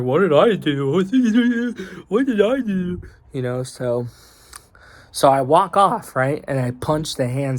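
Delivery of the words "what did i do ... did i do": high-pitched mimicry of a baffled coworker; put-on high voice of bewildered innocence; mock protesting coworker. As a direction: imitate him protesting, voice climbing higher. Mimics Chad's startled protest.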